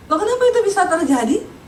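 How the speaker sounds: ordinary delivery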